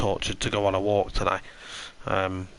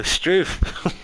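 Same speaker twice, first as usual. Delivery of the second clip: slow, drawn-out Aussie "Struth"; a jokey Aussie exclamation; mock Aussie accent; 8 semitones higher than usual. Keeping up the Aussie bit for chat, hammed up. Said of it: "in a full Aussie accent".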